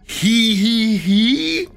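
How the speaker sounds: high mock giggle, parroting him; incredulous high mimicry of a creepy laugh. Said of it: "mimicking the creepy laugh again".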